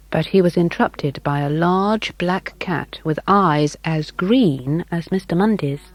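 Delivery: ordinary delivery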